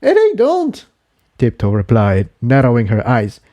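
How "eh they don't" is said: higher character voice; lightly raised pony voice; voiced mare character. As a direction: read the dialogue as a young mare. Character dialogue voiced high.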